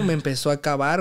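ordinary talk

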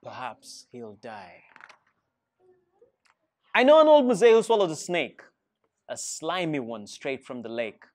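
plain reading voice

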